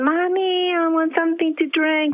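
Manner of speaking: high, lightly voiced small child asking for juice; a hint of a child calling for mommy; little boy asking. Small son asking his blind mother for a drink, high child voice.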